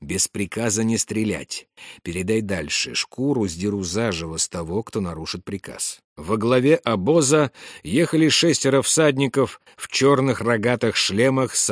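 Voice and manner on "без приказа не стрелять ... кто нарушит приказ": soft, lowered voice, still voiced; hushed whispered order. A narrator's reading. Officer warning his archers before the ambush, in a hushed voice. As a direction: pass the threat on quietly. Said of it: "прошептал он".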